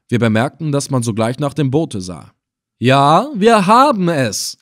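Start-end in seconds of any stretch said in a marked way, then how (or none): 2.8-4.6 loud, drawn-out taunting call; calling out slowly. Acted. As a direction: call it slowly across the water. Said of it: "antwortete ich in derselben Weise". (laut und gedehnt herüberrufen)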